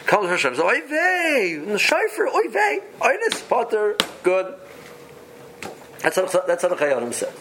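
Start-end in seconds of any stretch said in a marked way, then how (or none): 0.7-4.5 high, hammed-up Yiddish oy vey; yiddish accent. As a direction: squeal it like a Yiddish old-timer, relieved. Imagined man's relieved exclamation.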